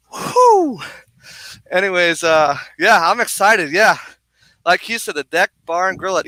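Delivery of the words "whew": high whoop of an exhale; winded "whew". Real, not acted. Host catching breath after running, winded exhale. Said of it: "Let me catch my breath".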